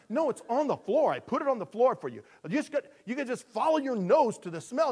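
high, loud exasperation; exasperated cat owner. Acted. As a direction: explain loudly to a clueless cat.

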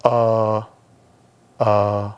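drawn-out low "uh", imitating someone caught out; mock having no answer, monotone; blank, stalling hesitation; stumped stammer. Imitates stumped unbeliever again.

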